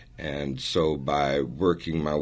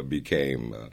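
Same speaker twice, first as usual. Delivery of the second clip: ordinary talk, nothing marked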